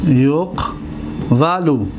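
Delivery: یقولو stretched as yaq-vu-lu, falling; slow model word. Shown as a demonstration. Teacher modeling the original form for the class. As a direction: draw out یقولو slowly. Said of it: "teacher writes and sounds word slowly".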